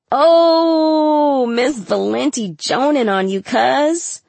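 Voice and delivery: high, drawn-out "oh" into a jeer; rowdy taunt. A narrator's reading. Classmates egging on a teacher's put-down, rowdy taunt. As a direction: hoot it like an egged-on classmate.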